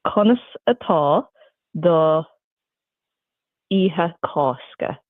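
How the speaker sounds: "Conas atá do Iha Cosca" spoken as Irish, the last words slowed; asked in Irish Gaelic. Shown as a demonstration. Asking the host about her Easter in Irish. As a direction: translate the question into Irish, unhurried. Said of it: "what was the rest of it then".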